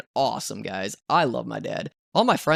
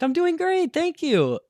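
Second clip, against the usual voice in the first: very high, singsong friendliness; chirpy thanks. Retold or imitated. Driver thanking passengers who asked after her, chirpy. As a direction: imitate a bubbly driver.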